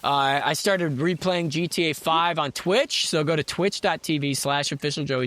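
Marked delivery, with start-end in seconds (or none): none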